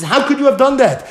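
high, incredulous reproach; acted reproach. Prophet to king over his crime, reproachful. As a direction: demand it, pitched well up.

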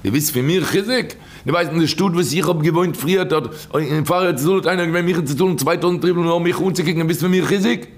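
insistent retold repeat. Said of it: "Er hat ihm noch einmal gesagt".

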